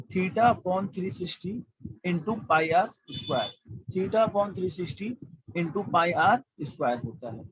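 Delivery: slow, word by word for copying down; dictation pace. Shown as a demonstration. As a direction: spell out the formula slowly. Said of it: "मेरे साथ साथ note करिए please इसको". (धीरे धीरे लिखवाना)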